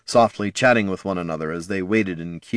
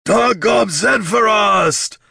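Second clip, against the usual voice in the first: slow, much higher and louder victory cry; triumphant orc shout; 14 semitones higher, 6 dB louder, much slower than usual. A narrator's reading. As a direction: bellow it to the crowd. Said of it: "he shouted back to the lines".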